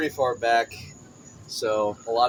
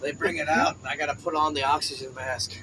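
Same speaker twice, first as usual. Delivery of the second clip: mock old parishioner; 5 semitones higher, slower than usual.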